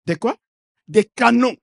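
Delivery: ordinary delivery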